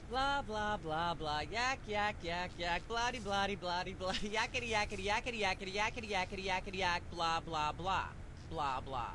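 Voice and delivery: the rant trailing off very soft into blah blah; a soft droning ramble; fading rant. Acted. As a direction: mumble the filler words far under the voice. Host rant fades into blah.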